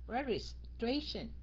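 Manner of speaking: "Registration" slowly, regis, pause, tration; cut into parts. Shown as a demonstration. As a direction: split "Registration" before tration. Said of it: "Mà mình chia nó ra". ("Registration" cắt ra, đọc chậm)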